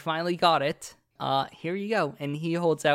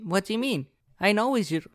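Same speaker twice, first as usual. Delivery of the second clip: excited dog voice; 5 semitones higher, 4 dB louder, faster than usual. Acted.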